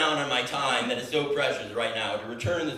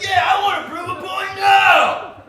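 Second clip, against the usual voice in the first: high, loud, slow defiant yell; defiant shout; 12 dB louder, slower than usual. Retold or imitated. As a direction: bellow it out like you're making a stand. Defiant reply to the cashier.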